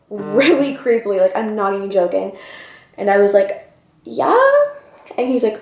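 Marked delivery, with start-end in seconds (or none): 4.0-4.6 very high, rising hesitant answer; wary yeah. Acted. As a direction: squeak it like an unsure question. Reluctant answer to creepy question.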